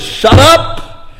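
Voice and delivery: high, sharp acted shout; crowd's rebuke. Crowd silencing the blind beggars, acted shout. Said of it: "while the crowd says".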